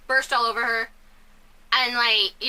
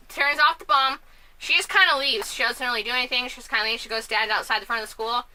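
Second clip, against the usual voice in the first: ordinary talk, nothing marked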